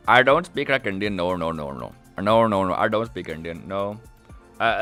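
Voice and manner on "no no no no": ordinary talk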